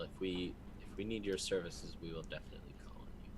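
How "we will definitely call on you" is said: fast, breathless whisper; whispered brush-off. Acted. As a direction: hurry the promise out under your breath. Polite brush-off to NPC.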